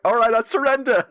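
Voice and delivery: high, pleading give-up voice; cowardly surrender. Retold or imitated. Patches giving up to the player mid-fight, high and pleading. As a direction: beg for mercy up high. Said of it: "he just, like".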